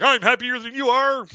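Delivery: imagined fight between happy people; mock happy fight. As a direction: yell it like a silly brawl.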